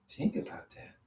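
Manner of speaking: laughing whisper. Retold or imitated.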